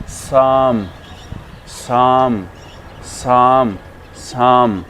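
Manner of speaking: साम with a held "aa", silent p; long aa sound. Shown as a demonstration. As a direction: stretch साम into "saaam". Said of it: "इसको बोलते हैं".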